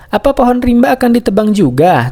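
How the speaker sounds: high-pitched, lightly acted alarm; storyteller's gently frightened squirrel; frightened question. A narrator's reading. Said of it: "tanya Tupai Momo ketakutan".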